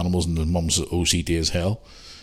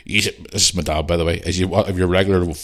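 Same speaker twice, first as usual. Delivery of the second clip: ordinary talk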